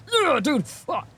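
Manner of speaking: high, frustrated groan of a curse; real flash of annoyance; exasperated outburst. Real, not acted. Venting about scammers in a high, frustrated outburst. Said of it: "I'm about to lose it".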